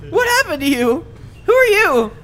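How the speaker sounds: high, loud, alarmed questions; alarmed shopkeeper. Acted. Gemini confronts the strange visitor, alarmed. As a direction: recoil and demand answers. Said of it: "She's like".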